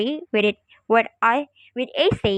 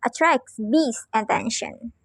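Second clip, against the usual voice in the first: normal talking voice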